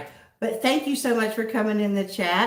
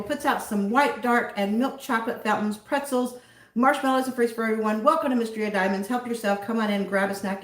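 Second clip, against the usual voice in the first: ordinary delivery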